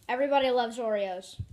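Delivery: ordinary talk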